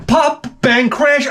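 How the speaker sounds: high mock yelps imitating tics; mock tic noises. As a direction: yelp the words up high. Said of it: "she's like".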